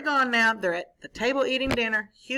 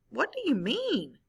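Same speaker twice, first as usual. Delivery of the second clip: put-on higher, puzzled boy's question; Hugo's puzzled voice; 4 semitones higher than usual. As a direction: ask it like a puzzled boy. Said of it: "Hugo said that".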